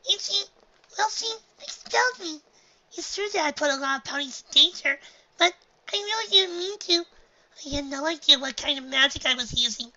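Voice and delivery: high, pleading filly voice; upset filly. A narrator's reading. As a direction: near tears, defending herself.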